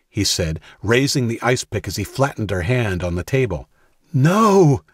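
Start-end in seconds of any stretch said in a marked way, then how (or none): 4.1-4.8 high, loud desperate cry; shouting. A narrator's reading. Jack crying out to stop Dawson, loud. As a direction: cry it out, much higher and louder. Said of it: "Jack called".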